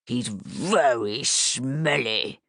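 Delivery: put-on low, slow monster growl for the kids; growly Gruffalo voice. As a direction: play the Gruffalo to make the girls giggle. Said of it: "best growly Gruffalo voice".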